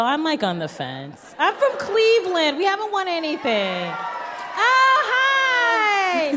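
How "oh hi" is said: high, excited squeal of hello; light up at a fellow Clevelander; enthusiastic. Real, not acted. Greeting a guest from Cleveland, high and thrilled. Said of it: "most enthusiastic I've ever seen".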